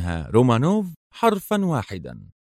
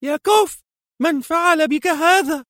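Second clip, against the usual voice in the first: very high, loud, terrified call; wife finding her husband bound; panicked cry; 3 dB louder than usual. A narrator's reading. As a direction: scream his name in horror. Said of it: "وهتفت في ذعر".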